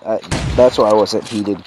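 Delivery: usual speaking voice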